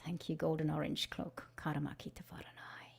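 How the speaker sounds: soft unvoiced whispered thanks. Facilitator thanking the golden orange cloak in a whisper. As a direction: breathe the thanks out gently.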